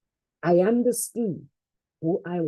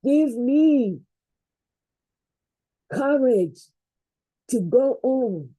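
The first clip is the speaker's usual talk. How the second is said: building prayer. Real, not acted.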